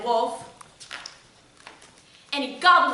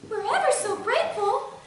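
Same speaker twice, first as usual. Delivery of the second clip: put-on high, sweet little-girl thanks; relieved storybook child; 9 semitones higher than usual. A narrator's reading. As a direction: gush gratefully, high-pitched.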